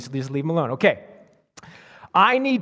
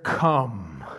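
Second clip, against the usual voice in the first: low, rumbling thunder-voice come; 7 semitones lower, 4 dB quieter than usual. A narrator's reading. Creature's low thunderous call to John. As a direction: drop it low like distant thunder. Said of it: "in a voice of, like thunder".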